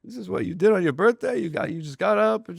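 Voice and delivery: imitated bafflement. Larry questions the stranger's birthday visit, baffled. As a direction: puzzle it out aloud in rising disbelief.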